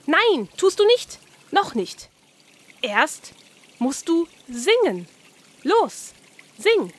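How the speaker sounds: the carp ordering Oskar to sing, high and insistent; bossy carp. Acted. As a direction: push him to sing.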